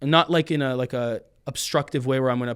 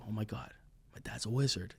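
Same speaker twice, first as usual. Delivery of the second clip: put-on hushed, awestruck child; whispered amazement at dad's magic; awed hush; 5 semitones lower, 13 dB quieter than usual. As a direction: breathe it out in wonder.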